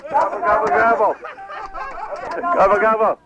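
gobbling turkey voice. Acted. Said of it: "louder, though".

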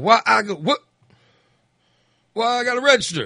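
a hint of an outraged man at the polls; imitating a mad voter. Angry man turned away at the polls, protesting loudly. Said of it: "he was, like, really mad".

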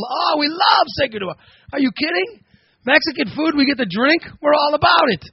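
high-pitched, over-the-top enthusiasm. Acted.

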